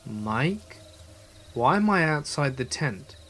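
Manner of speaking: hesitant retold question with pauses; slowly, as if puzzled; slow, dazed question. Sleepwalking friend asking narrator, slowly. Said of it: "and slowly said".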